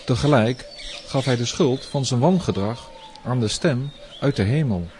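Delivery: usual speaking voice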